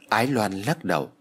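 usual speaking voice

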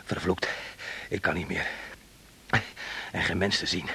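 out of breath. Acted. Exhausted alone after flight.

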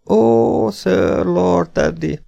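high, slow pained groan. Acted. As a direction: moan it out like a wounded man. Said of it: "nyögte a félvér". (magas, lassú nyögés)

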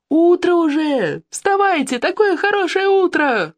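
slow, singing-out high cries; acted wake-up calling. A narrator's reading. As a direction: rouse the camp with long, high-pitched calls. Waking everyone by calling out. (высокий протяжный крик, будим лагерь)

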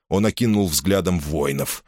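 usual speaking voice

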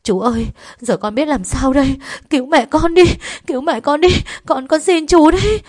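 high, loud hysterical begging; desperate screaming. A narrator's reading. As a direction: wail and plead for mother's life. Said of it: "gào thét".

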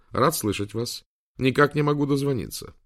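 lightly voiced, unruffled helper, rising in pitch; calm AI assistant. A narrator's reading. AI assistant reaching the professor after many calls, unruffled. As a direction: stay perfectly composed and courteous. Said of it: "совершенно спокойному и невозмутимому тону Уинстона".

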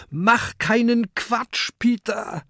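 high-pitched, urgent shout; alarmed call. A narrator's reading. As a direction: call after a friend to stop. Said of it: "ruft Kokosnuss".

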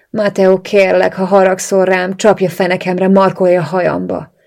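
loud, insistent begging; desperate stammered plea. A narrator's reading. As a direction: beg the partner loudly, without letting up. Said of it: "dadogtam remegő lábbal".

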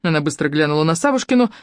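plain reading voice

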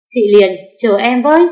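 loud, high call from behind. A narrator's reading. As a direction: shout after her to wait. Calling after her outside.

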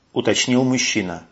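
ordinary delivery

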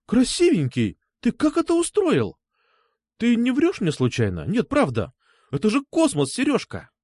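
put-on high, gushing girl's voice; amazed delight. A narrator's reading. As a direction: play an overjoyed girl who can hardly believe it. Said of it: "У Насти глаза стали по полтиннику". (восторженно, с изумлением)

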